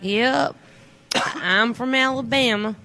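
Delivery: put-on slow, stretched Alabama accent; young Forrest's slow Alabama drawl. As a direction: stretch every word like a boy from Alabama. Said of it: "everything was elongated".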